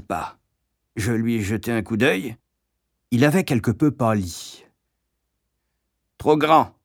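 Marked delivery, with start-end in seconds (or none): 6.2-6.7 lightly voiced cross shopkeeper, higher; Madame Pratchett. A narrator's reading. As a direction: pronounce a curt verdict. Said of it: "entendis-je Madame Pratchett déclarer". (mégère légèrement jouée, voix plus aiguë)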